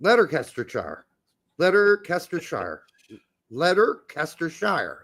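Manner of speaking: Letterkestershire sounded out syllable by syllable; literal mispronunciation. Shown as a demonstration. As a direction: Letterkestershire ending in a full "shire". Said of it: "That's gotta be it".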